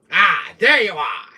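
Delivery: slow, loud villain greeting; Wesker voice. Acted. Streamer spotting chat, slow and menacing as his villain. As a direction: draw it out like a villain finding his prey. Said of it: "I love doing my Wesker voice".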